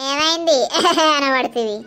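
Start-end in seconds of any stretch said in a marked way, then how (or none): 0.6-1.1 short laugh. Acted. Laughs at the neighbour.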